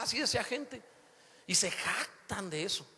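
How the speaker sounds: ordinary talk, nothing marked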